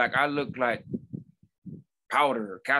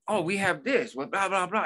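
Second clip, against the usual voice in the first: put-on teen cashier rattling off; 6 semitones higher than usual. Kid rattles off bread list.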